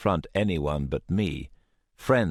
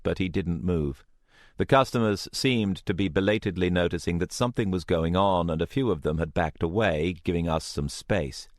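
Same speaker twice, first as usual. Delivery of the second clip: ordinary delivery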